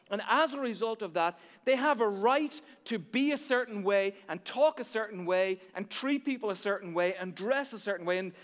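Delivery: loud, driving sermon build; preaching at full force. Acted. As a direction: hammer it home to the congregation.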